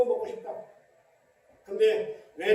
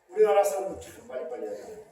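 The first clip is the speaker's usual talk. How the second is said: normal talking voice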